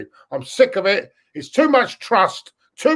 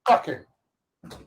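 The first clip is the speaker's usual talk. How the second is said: usual speaking voice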